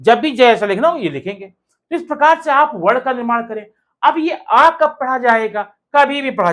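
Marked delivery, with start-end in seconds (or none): none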